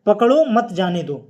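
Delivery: पकड़ो मत जाने दो in one loud, unbroken run; no pause anywhere in पकड़ो मत जाने दो; all run together. Shown as a demonstration. Teacher showing the sentence without a comma, all run together. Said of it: "पूरा एक साथ कहा गया".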